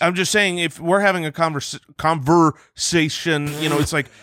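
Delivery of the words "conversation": "conversation" broken up slowly, leaning on each syllable; over-emphasized syllables. Shown as a demonstration. Making a point about yawning through talk, over-emphasized "conversation". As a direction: draw out "conversation", stressing "ver" and "sation". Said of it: "Did you emphasize sation".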